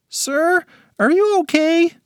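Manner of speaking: put-on high, concerned child voice; worried kid. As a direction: ask anxiously. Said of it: "asked one of the other kids".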